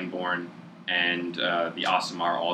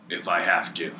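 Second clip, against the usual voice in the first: terse half-orc. Acted. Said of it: "The half-orc just says".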